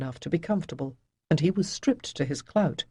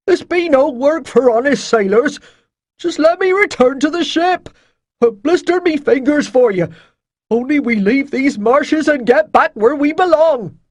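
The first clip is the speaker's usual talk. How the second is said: higher, louder whining begging; pleading sailor; 9 semitones higher, 9 dB louder than usual. Acted. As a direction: beg to return to the ship. Avon pleads to go home.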